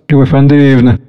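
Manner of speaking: ordinary talk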